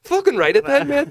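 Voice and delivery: emphatic shout. Real, not acted. Emphatic answer to friend's tease.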